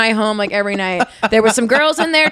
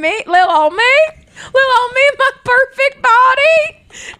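hammed-up coy self; 13 semitones higher, 3 dB louder than usual. Mock-modest retelling to date.

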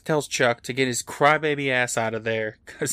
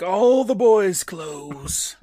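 higher, slow, drawn-out creepy voice; greedy mask voice; 6 semitones higher, 5 dB louder, much slower than usual. Retold or imitated. Mask eyeing Chuck's clothes, slow and creepy. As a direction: savor every word like a ghoul.

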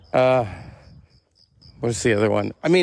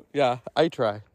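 usual speaking voice

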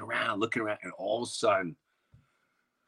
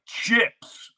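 high, sharp claim; calling chips; 9 semitones higher, 5 dB louder than usual. Retold or imitated. Kid calling chips on a new ball, high and sharp. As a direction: bark the word out to claim the ball. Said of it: "I'm like".

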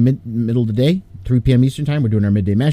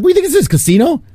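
high, louder mock disbelief; retold incredulous retort; 5 dB louder than usual. Retelling his reply to friends' mobster joke, mock incredulous. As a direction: throw it back as an incredulous comeback. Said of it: "I'm like".

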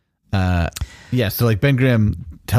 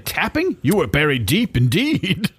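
high, grand mock-archaic god; hammy Thor voice; 7 semitones higher than usual. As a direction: boom it out like a theatrical god. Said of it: "He's like".

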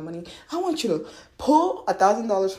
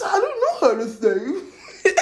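high, loud, drawn-out whining; hammed-up helpless complaint; mock helpless whine; 11 semitones higher, 5 dB louder, slower than usual. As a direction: whine it slowly, laughing at them. Mimics listener's helpless objection.

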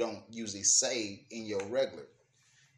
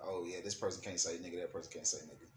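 mocking quote; 5 semitones lower, 7 dB quieter, faster than usual.

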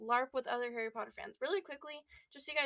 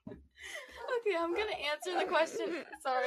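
laughing; 5 semitones higher than usual. Real, not acted. Trying to stop laughing.

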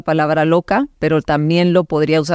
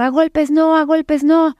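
mock shout carried by pitch alone; retold yelling; 11 semitones higher, slower than usual. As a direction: imitate a bystander crying out in protest. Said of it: "empezó a gritar".

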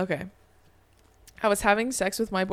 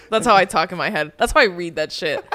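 normal talking voice

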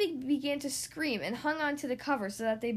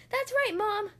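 put-on very high little-boy voice; agreeing child; 10 semitones higher, 4 dB louder than usual. A narrator's reading. Luke backs up Lester to Mom, agreeing. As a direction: chime in eagerly. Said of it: "he agreed".

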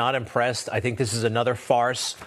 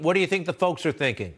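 ordinary delivery

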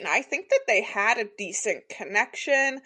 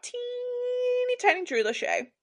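put-on teeny tiny voice, pitched way up; high squeaky voice; 5 dB quieter than usual. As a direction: squeak it out small and cute. Mock dramatic tiny voice.

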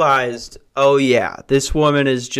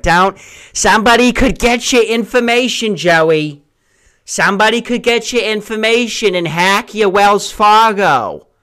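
high, louder worried parent voice; boomer parents; 8 semitones higher, 5 dB louder than usual. Retold or imitated. Parents warning their son about online card use, boomer worry. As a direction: nag like an anxious boomer parent. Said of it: "they just sound like complete boomers".

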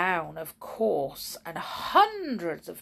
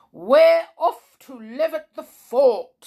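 loud, higher proud announcement; 7 semitones higher, 11 dB louder than usual. Acted. Captain marching his soldiers off, proud call. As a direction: say it chest out, proudly. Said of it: "said the captain proudly".

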